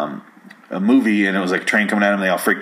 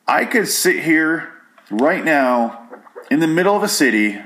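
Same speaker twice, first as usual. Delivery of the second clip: ordinary talk, nothing marked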